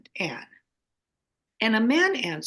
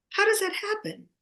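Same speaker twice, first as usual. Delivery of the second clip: higher with wonder, near a squeal; amazed rhetorical question; 10 semitones higher than usual. Real, not acted. To the host, amazed at her own coincidence. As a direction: ask it as if it still baffles you.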